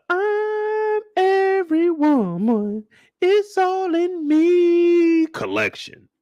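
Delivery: very high, slow, put-on announcer voice; fashion announcer. Introducing the clothing collection, slow and very high. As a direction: drawl it out like a grand runway announcer.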